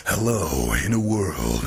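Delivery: deep, slow trailer announcer; movie trailer voice. Shown as a demonstration. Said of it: "that type of thing".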